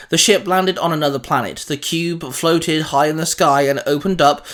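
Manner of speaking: plain reading voice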